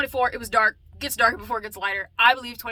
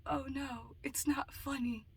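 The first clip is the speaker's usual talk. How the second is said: hushed whisper, slow on not funny; whispered verdict; 2 semitones higher, 12 dB quieter, slower than usual. Pretend. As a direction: whisper it to the child, deadpan. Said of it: "I'll be like".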